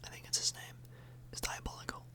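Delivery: ordinary delivery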